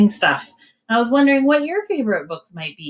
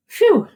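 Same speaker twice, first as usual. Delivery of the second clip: relieved phew; 12 semitones higher, 5 dB louder than usual. Acted. Relief as ducks return.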